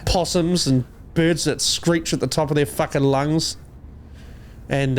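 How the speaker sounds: normal talking voice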